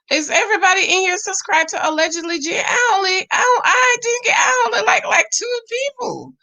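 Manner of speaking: high, loud fake-crying wail; mock crying whine. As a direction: ham up a teary complaint about two subscribers. Mock complaint about few subscribers.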